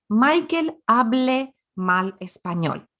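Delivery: slow, deliberate model sentence; slow, careful example. Shown as a demonstration. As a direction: say it slowly, word by word. Teacher voices second example sentence.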